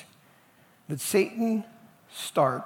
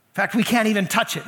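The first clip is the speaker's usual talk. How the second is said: high, loud overstatement; exaggerating; 4 semitones higher, 5 dB louder than usual. Eve overstating the rule to the serpent, exaggerating. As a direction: push the claim too far. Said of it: "Now she's exaggerating".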